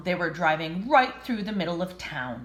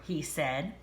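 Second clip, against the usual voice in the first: ordinary delivery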